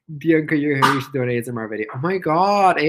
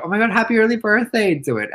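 ordinary talk, nothing marked

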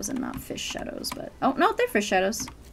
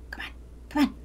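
ordinary delivery

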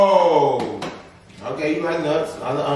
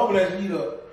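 normal talking voice

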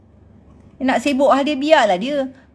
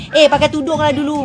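mock preachy critic; 4 semitones higher, 4 dB louder than usual. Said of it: "Kau sentuh pasal agama je".

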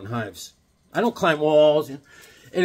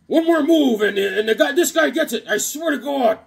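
high, loud hostage-taker voice; hammed-up villain threat; comic villain threat; 8 semitones higher, 4 dB louder than usual. Villain line from comic cover.

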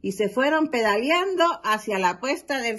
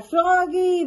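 higher, louder call of the name; 7 semitones higher, 4 dB louder than usual. Acted. Dad calling Froggy again, raised call. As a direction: call him again, impatient. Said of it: "le grita".

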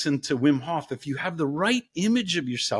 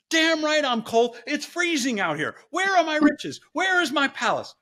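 entitled brat; 11 semitones higher than usual. Retold or imitated. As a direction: demand the riches like a spoiled brat. Greedy daughter demands riches.

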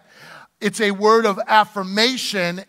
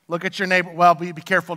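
ordinary talk

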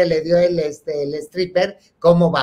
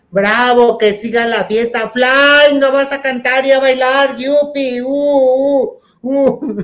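higher, louder party whooping; cheering shout; 9 semitones higher, 8 dB louder, slower than usual. Retold or imitated. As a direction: cheer and whoop like a rowdy crowd. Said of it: "empezamos a cantar y a gritar".

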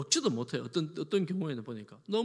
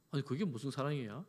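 ordinary talk, nothing marked

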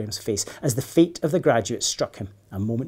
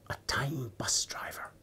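hushed, slow, breathless awe; in wonder; 11 dB quieter, slower than usual. A narrator's reading. Girl marvels at the bus driver, awed whisper. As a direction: whisper it, amazed. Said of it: "said Peyton in wonder".

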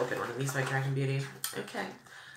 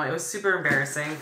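usual speaking voice

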